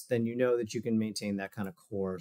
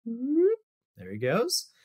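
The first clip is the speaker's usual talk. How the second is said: drawn-out cheer; 10 semitones higher, much slower than usual. Real, not acted. Cheering on the video subject.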